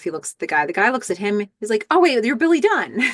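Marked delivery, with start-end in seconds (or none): 1.9-3.0 high, hammed-up recognition; starstruck surprise. Angry man recognizes Billy Dunn, suddenly starstruck. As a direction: imitate a sudden delighted realization.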